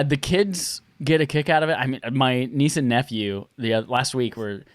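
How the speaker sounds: ordinary delivery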